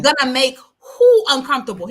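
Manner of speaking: high, loud incredulous retort; retold indignation. Actor retold pushing back on studio executives, indignant. Retelling actor's outraged refusal.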